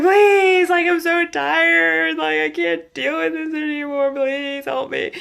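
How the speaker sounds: high, loud pleading wail; ugly crying prayer. Retold or imitated. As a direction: beg God at your wit's end. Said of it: "the ugly crying out to the Lord".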